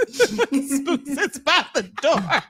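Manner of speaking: slow, laughing delivery. Real, not acted. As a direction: say it while cracking up. Said while laughing.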